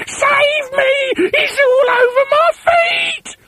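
very high, loud panicked shriek; screaming for help. A narrator's reading. Wife shrieks at the thing in her bed, panicked scream. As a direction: shriek in terror. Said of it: "screamed Missus Twit".

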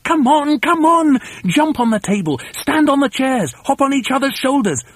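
high, frantic shouted orders; high-pitched urgent bossy rant; frenzy of excitement. A narrator's reading. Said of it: "in a frenzy of excitement".

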